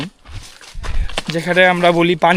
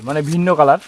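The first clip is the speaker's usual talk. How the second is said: ordinary talk, nothing marked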